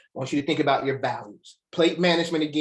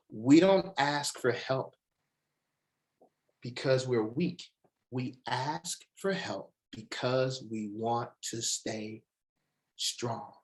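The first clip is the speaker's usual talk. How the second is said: slow emphatic; 3 semitones lower, 4 dB quieter, slower than usual. Acted. Motivational speaker stressing key line.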